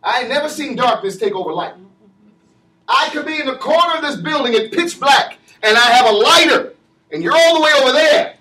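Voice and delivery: fiery pulpit cadence, shouting the key words; preaching at full force. Acted. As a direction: drive it home to the congregation.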